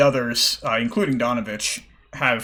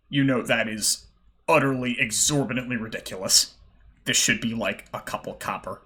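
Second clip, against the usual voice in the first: normal talking voice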